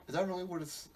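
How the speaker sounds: ordinary delivery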